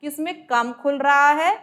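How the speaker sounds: normal talking voice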